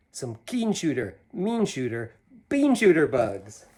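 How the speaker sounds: put-on lively read-aloud to kids; animated picture-book reading; story narration. A narrator's reading. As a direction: read the rhyme with a bouncy lilt. Reads Seuss poem to children.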